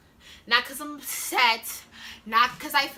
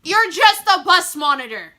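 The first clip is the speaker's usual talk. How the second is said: louder, each word hit; loud dismissal; 10 dB louder than usual. Real, not acted. Student dismissing the bus monitor, loud and firm. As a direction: put her in her place. Emphatic repeat.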